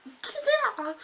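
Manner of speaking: high squealed yeah; excited reaction. Retold or imitated. Fan cheering on his rampage, high excited squeal.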